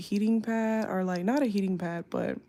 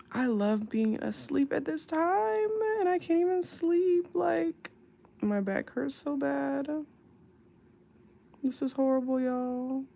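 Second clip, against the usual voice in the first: tearful complaint; 6 semitones higher than usual. Real, not acted. Tired streamer telling viewers her back hurts, whining miserably. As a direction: whine it higher, pleading for sympathy. Complaining she can't sleep for pain.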